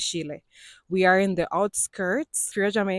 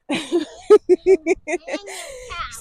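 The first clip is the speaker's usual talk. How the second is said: high laugh; 9 semitones higher, 6 dB louder than usual. Real, not acted. Laughs about three boys.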